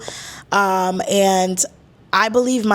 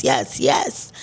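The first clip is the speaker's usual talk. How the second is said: whispered; 7 semitones lower, 3 dB quieter than usual. Acted. Whispered low echo of yes.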